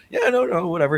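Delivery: squeaky high mock brush-off; dismissive AI person. AI-as-person shrugging off being caught, squeaky mock brush-off. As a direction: wave it away in a silly high voice. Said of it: "And he's like".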